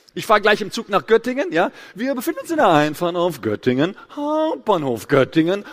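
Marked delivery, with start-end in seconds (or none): 1.9-5.6 parodied train announcer with odd swooping melody; Zugdurchsage. Retold or imitated. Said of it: "hat der was genommen?". (nachgeahmte Zugdurchsage, seltsam gedehnter Singsang)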